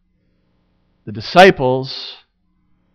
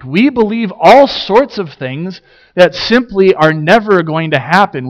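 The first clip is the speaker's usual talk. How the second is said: ordinary delivery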